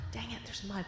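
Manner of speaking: put-on a sighing little boy giving up; resigned sigh. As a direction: let the air out in defeat. Boy resigned about the mud.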